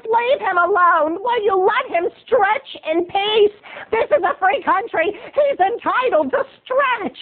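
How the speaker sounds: put-on very high, slow scolding old woman; hammed-up bitter old lady, drawing words out. Old lady defends the man stretching, bitterly. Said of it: "this old bitter lady at the bus stop".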